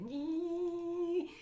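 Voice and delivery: long, piercing high squeal; dentist drill imitation. Comic warning about the dentist, high drill whine. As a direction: imitate a drill whining up high and holding there.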